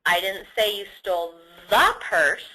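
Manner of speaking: "the" stretched and punched hard; stress on THE. Shown as a demonstration. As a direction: lean on "the", meaning that particular purse. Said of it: "but you stole another purse".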